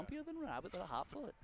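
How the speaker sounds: put-on high, fast old-timey announcer voice. Shown as a demonstration. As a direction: ham it up like an old-time radio man. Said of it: "he says it in an old-time voice".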